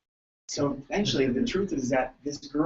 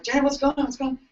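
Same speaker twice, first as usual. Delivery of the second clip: much higher alarmed question; alarmed asking; 10 semitones higher, faster than usual. Retold or imitated. Chiropractor asking the screaming grandma what happened, high. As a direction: ask in a startled, high rush. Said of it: "it's like".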